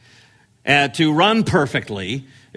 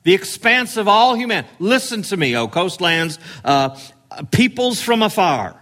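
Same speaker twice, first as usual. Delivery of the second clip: sweeping proclamation; 4 semitones higher than usual. Shown as a demonstration. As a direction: call out to everyone at once. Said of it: "He's saying".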